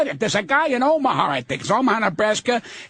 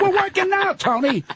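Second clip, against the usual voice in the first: exasperated; 9 semitones higher than usual. Retold or imitated. Arguing with [name].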